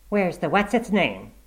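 high, slow, demanding wife voice; nagging wife. A narrator's reading. Wife asking about the forgotten errand, nagging. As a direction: nag him like an exasperated wife. Wife's imagined nagging question.

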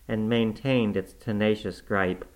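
plain reading voice

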